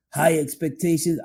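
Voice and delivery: ordinary talk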